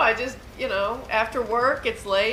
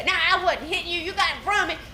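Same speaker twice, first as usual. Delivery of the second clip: mild mock denial; 8 semitones higher, much faster than usual. Driver voiced denying in quarrel.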